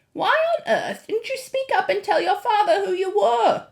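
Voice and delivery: high, loud exasperated telling-off; scolding grandmother. A narrator's reading.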